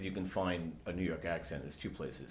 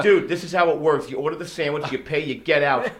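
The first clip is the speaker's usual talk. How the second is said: loud, higher, laying down the rules; exasperated lecture; 5 semitones higher, 12 dB louder than usual. Retold or imitated. Speaker to the tourist, loudly explaining how ordering works. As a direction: spell out the New York drill, fed up. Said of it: "I'm like".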